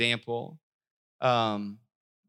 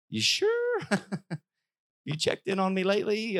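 high, doubtful "you sure?"; skeptical, high-pitched challenge to God; incredulous question; 6 semitones higher than usual. Retold or imitated. Preacher voicing a doubter questioning God, incredulous and high.

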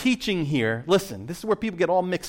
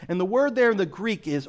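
usual speaking voice

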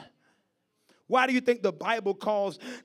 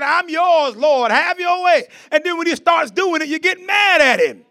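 raised, scolding sermon voice; impassioned preaching; 8 semitones higher, 8 dB louder than usual. Acted. Preacher voices God rebuking a complaining believer, impassioned.

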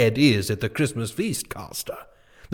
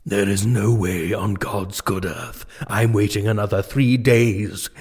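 normal talking voice

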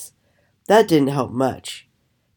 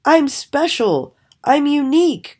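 high-pitched, showy boast; boastful; 12 semitones higher, 3 dB louder than usual. A narrator's reading. Raven bragging to silent fellow ravens, boastfully. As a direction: proclaim it high and proudly. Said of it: "Crawford boasted".

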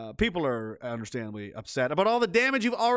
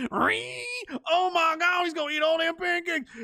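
high, loud, gasping amazement; mock astonished onlooker; 3 dB louder, slower than usual. Bystander gasps at the pancake feast, high and amazed. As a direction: act stunned by a pancake eater.

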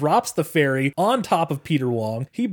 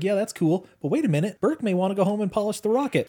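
higher-pitched mock mom voice; imitated mom; 6 semitones higher than usual. Mom going along with dad's yogurt idea, imitated mom voice. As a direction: lightly voice an agreeable mother, pitched up. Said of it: "Mom's like".

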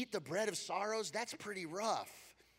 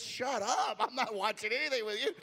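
laughing brush-off; 6 semitones higher, 5 dB louder than usual. Retold or imitated. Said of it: "She goes".

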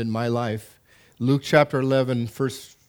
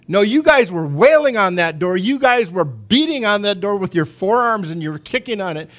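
fervent preaching; 6 semitones higher, 5 dB louder than usual. Real, not acted. Preacher praising the church's prayer.

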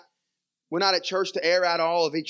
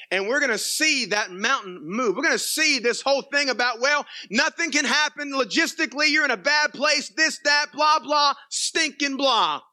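rising preaching fervor; 7 semitones higher than usual. Real, not acted. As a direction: preach it up till it peaks. Preacher building to full force.

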